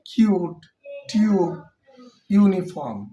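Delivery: teacher modelling "ইউ" in "কিউট", "টিউব", "ইউনিফর্ম"; clear example words. Shown as a demonstration. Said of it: "যেমন".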